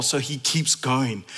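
ordinary talk, nothing marked

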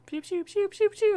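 high zapping blaster noises; pew pew zaps. Retold or imitated. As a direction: make little laser zaps. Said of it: "That's the Iron Man sound".

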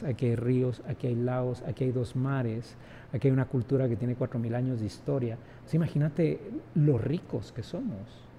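normal talking voice

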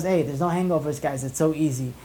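ordinary talk

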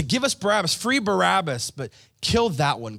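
loud, urgent crowd cry; crowd's demand. Acted. Crowd demands Barabbas be freed, loud demanding call.

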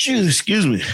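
just after a sneeze; sneezing. Real, not acted. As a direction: excuse me right after sneezing. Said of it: "That was [name] sneezing on the mic".

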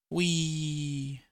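high, long-held whee; one sustained high note of glee; gleeful whee. A narrator's reading. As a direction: squeal it out as you leap. Snowflakes jump off the tree.

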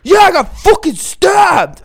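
high, slow, loud wail; hammed-up shriek to Mom; imitating hysterical friend. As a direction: shout it in drawn-out mock panic. Hysterical friend retold on phone to mom.

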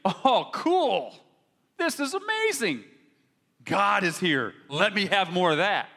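high, thrilled gushing newcomer; mock excitement. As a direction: ham up a wide-eyed visitor's delight. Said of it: "They're not gonna think".

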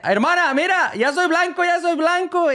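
put-on high-pitched child bragging to his sister; high kid voice. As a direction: squeak it out like a thrilled little boy.

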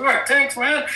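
very high, eager thanks; high-pitched mock gratitude; retold nervous thanks. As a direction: act out thanking a cop who lets you go. Relieved reply to departing cop.

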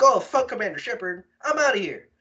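imitated fleeing Joker. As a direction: play a pilot bailing on the commander. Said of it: "Well, he's like".

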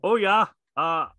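hammed-up Upper Peninsula Michigan accent. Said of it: "put on an accent".

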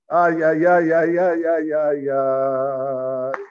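loud "ay, ay, ay" wail trailing away; mock lamenting chain of ays; long, chanted groan of dismay; drawn-out lament. Lamenting over weddings lost to money.

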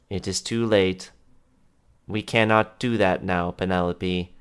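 lightly voiced villain. Villain speaks, low and grave.

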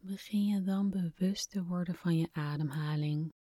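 hushed guided meditation voice; breathy, calm whisper guiding relaxation. Acted. Guided relaxation exercise begins.

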